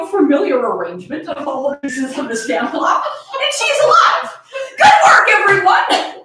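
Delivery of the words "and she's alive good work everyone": high, loud triumphant cry of victory; triumphant cheer. Acted. Haruka cheering after finally getting into the dress, loud and triumphant. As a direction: cheer it like winning a marathon.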